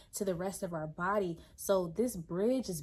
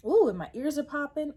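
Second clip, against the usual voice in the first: excited exclamation; 6 semitones higher, 9 dB louder than usual. Real, not acted. Sudden surprise at ears popping.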